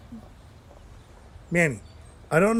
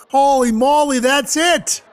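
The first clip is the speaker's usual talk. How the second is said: loud, high burst of sudden realization; a high, loud eureka shout; excited outburst; 9 semitones higher, 7 dB louder, slower than usual. Acted. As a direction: blurt it out like you just solved it.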